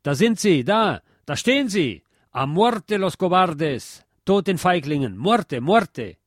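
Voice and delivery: rally the mob against the officers, the Spanish drawn out slowly; furious crowd shout. A narrator's reading. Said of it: "der Wutschrei".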